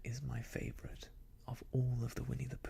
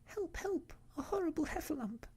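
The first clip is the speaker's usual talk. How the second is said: mock panic. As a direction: squeal a pretend cry for help. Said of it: "I often find myself saying".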